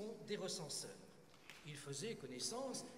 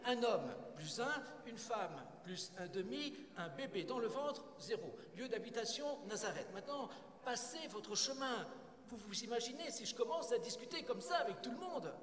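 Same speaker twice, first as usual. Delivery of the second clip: higher, brisk tallying and dismissal; impatient official; 6 semitones higher than usual. Acted. Census taker dismissing a couple from Nazareth, impatient. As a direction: rattle off the count and send them away.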